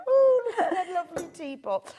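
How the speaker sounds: high, loud, lightly posh gush. Pretend. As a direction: gush over the teapot like a posh lady. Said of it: "Just feel like I had to go".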